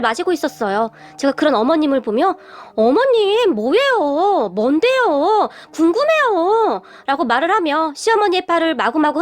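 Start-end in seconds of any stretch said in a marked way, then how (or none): 2.8-6.8 higher, drawn-out sing-song begging; coaxing curiosity, long vowels rising and falling; wheedling plea. A narrator's reading. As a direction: pester mother-in-law with stretched, pleading endings. Daughter-in-law shaking arm, begging to know.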